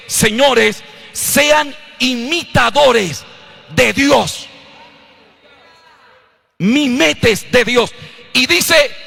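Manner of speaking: loud, slow, stretched preacher's cry; shouting the scripture from the pulpit; full-force preaching. Acted. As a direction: thunder the verse at the congregation.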